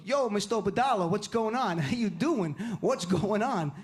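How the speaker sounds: put-on thick Sopranos-style Jersey accent. Jersey kids greet a friend's dad, Jersey accent. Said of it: "they have that accent".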